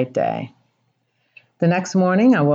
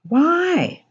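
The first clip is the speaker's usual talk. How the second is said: plaintive why; 11 semitones higher than usual. Real, not acted. Bewildered cry of why.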